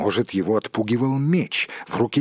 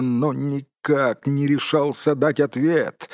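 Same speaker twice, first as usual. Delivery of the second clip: ordinary delivery, nothing marked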